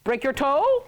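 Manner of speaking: "Break your toe" said quickly so it sounds like "Boker Tov"; demonstrating "Break your toe" as a stand-in for "Boker Tov"; sound-alike phrase. Shown as a demonstration. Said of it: "they're going to hear Boker Tov".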